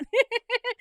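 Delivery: short high laugh; laughing at your own joke; amused laugh. Real, not acted. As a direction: burst out in a quick high cackle.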